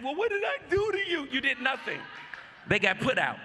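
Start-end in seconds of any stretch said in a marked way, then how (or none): 0.0-1.3 higher, quicker mock plea; mock whiny question. Mocking someone begging a cut-off friend for answers. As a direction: mimic a needy texter. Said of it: "I need you not to be asking".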